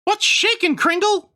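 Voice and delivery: high squeaky Christmas elf; chirpy elf shopkeeper, way up high. Acted. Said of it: "do I do an elf voice?".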